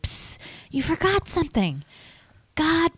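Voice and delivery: put-on small child voice.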